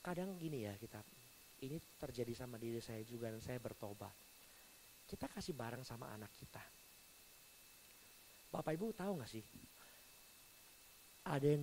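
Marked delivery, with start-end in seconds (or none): none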